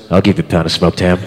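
low, leering mock come-on; creepy guy voice. Creep in line hitting on the girl, low leer. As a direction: drop low and sleazy for the creep. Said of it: "The guy behind me goes".

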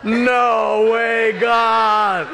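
high, loud, drawn-out protest; loud acted-out outcry; retold shouted refusal. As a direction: holler it in comic disbelief, stretching each word. Retold shocked refusal to God.

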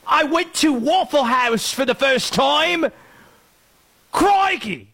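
high-pitched mock Australian tourist; Australian or English accent. Radio host mimicking World Cup fans experiencing America, hammed-up Australian accent. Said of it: "They're all gonna sound Australian or English".

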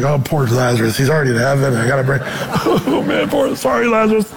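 low, put-on pity breaking into laughter; mock-sorrowful Jesus. Pastor voices Jesus pitying Lazarus in heaven, laughing. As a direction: sigh over Lazarus, then crack up. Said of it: "He's like".

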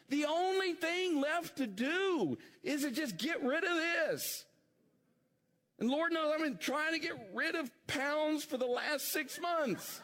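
high-pitched, fervent preaching; sustained high preacher's cry; impassioned preaching. Real, not acted. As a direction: drive it home from the pulpit, pitched high.

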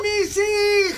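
high, slow ghostly wail; La Llorona wail. Retold or imitated. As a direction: cry it out like a wailing ghost. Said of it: "se escuchaba La Llorona".

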